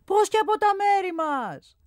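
high, loud exchange across a distance; calling back and forth. A narrator's reading. Shouted exchange between scaffold and ground, high and loud. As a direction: keep shouting up to be heard.

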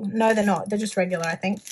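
normal talking voice

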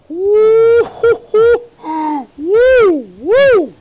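high, loud whooping; excited whoops. Real, not acted. Showing off his house, whooping loud and high.

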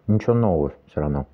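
ordinary delivery

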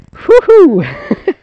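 loud mock whoop. Real, not acted. Mock cheering.

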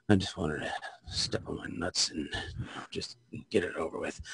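bleak whisper. Shown as a demonstration. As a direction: breathe it out like you've given up. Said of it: "a different inflection on it".

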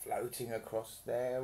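plain reading voice

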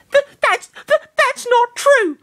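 put-on flustered girl, voice up high. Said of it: "Florina whispered".

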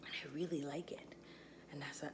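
soft hushed voice; whispering. Real, not acted. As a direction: drop to a whisper. Whispered aside while eating.

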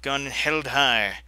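usual speaking voice

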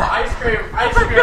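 high, loud urgent call; urgent excited. Real, not acted. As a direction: hurry him to the ice cream. Urging him to grab ice cream.

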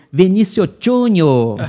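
proud football name. Retold or imitated. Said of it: "Aí ele falou assim".

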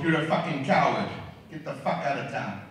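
ordinary delivery

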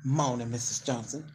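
lightly voiced old folks' morning greeting; a hint of a proud elder greeting a neighbour; old-timey greeting. Host imitating old folks greeting neighbours in the morning. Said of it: "When they used to do moaning".

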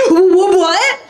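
high, stammered disbelief; hammed-up startled squeal; mock shock. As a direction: stammer in exaggerated astonishment. Manager gasps at surprising praise.

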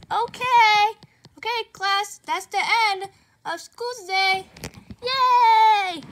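loud bright teacher announcement. Acted. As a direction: call the class to order. Teacher dismissing class.

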